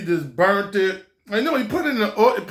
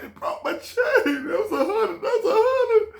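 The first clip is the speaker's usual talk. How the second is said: whiny protest; 13 semitones higher than usual. Retold or imitated. Chain broken in half.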